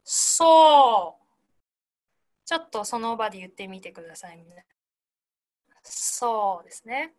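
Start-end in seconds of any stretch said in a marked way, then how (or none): 0.0-1.2 "ソ" stretched, hissed s into a held vowel; breath sent through. Shown as a demonstration. Teacher modelling for students to repeat, "ソ" long and strongly released. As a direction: push "ソ" onward on the breath. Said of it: "送っていく感じ".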